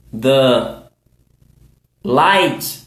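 normal talking voice

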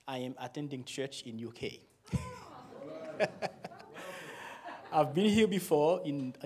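ordinary delivery

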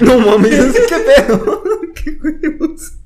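high, loud laughing, words slowed; real laughter of disbelief. Real, not acted. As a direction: laugh out the shock. Shocked laughter at murder story.

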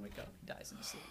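hushed spooky whisper. A narrator's reading. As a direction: whisper the ending to scare. Scary story climax whisper.

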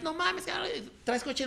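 squealing-high happy disbelief; excited high exclamation. Retold or imitated. Old friend outside the show, thrilled, pitched high. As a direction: burst out with pitch way up, delighted.

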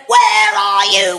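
high, loud, slow panicked shout; frantic parent shout. Retold or imitated. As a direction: imitate worried parents yelling down the phone. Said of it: "and they went".

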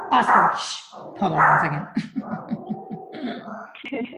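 turned aside, away from the mic; turning away from phone. Real, not acted. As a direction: voice drifts off-mic to the dog. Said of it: "that was my dog".

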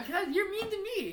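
high-pitched playful accusation; mock-hurt squabbling. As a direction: squabble like a wronged child. Mock squabble between co-hosts.

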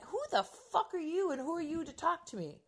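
affronted, high and hushed; acted incredulous retort. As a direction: retell it in squeaky disbelief. Mother's retort to the child, acted.

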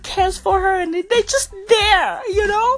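high, gushing mock fangirl voice; gushing social media girl. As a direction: pitch it way up, all swooning and emotional. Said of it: "these fucking girls on social media going".

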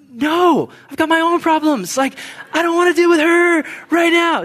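very high, loud, hammed-up tantrum; petulant refusal. Reluctant believer refusing God's nudge to encourage someone, mock tantrum. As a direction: whine it loudly like a balking child.

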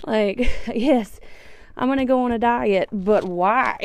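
laughing loudly while talking. Real, not acted. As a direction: talk through a big laugh. Laughing rant about dieting.

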